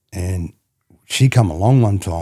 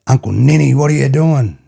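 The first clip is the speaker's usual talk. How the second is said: niece calling out; 7 semitones higher, 7 dB louder than usual. Retold or imitated. Niece retold calling uncle.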